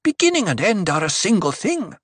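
high, impatient retort; irritated priestess's pronouncement; snapped. A narrator's reading. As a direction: snap it down at the petitioner. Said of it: "Aliyah snapped".

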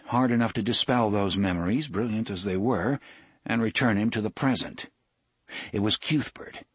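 plain reading voice

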